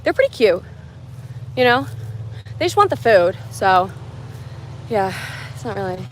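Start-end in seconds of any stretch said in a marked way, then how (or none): none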